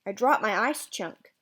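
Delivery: ordinary delivery, nothing marked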